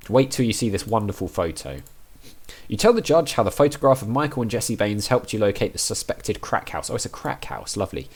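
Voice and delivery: ordinary delivery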